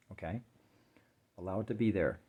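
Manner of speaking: ordinary talk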